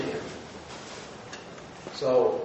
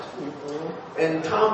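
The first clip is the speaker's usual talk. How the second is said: ordinary talk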